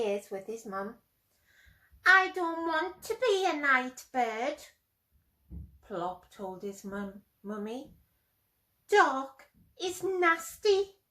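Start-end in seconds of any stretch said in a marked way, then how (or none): 2.1-4.6 whiny owlet. Acted. Plop the baby owl complains. 8.9-11.0 whiny owlet. Acted. Plop the baby owl complains.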